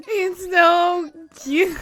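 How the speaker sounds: high, loud, drawn-out wailing squeal; overwhelmed squeal. Real, not acted. Viewer overcome by cute Pikachu, loud squealing wail. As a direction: squeal as if the cuteness is too much.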